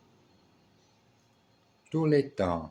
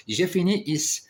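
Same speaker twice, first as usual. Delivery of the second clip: cheery sign-off; 9 semitones higher, faster than usual. Real, not acted. Wraps up lesson cheerfully.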